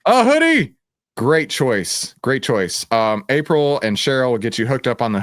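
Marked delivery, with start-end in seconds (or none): 0.0-0.7 excited. Real, not acted. Winner picks a hoodie.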